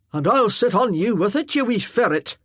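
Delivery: lightly voiced Highland warrior, heartfelt; earnest Highland warrior. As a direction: speak plainly and earnestly to the lass. Arter's playful threat.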